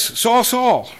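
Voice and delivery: loud, high call of the name; emphatic call. Retold or imitated. The voice calls Saul twice for attention, emphatic. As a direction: call it out to grab his attention. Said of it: "to draw attention and emphasis".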